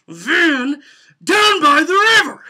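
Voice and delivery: loud "Van down by the river" held back a notch; lighter take. Shown as a demonstration. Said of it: "sometimes you put a little less into it".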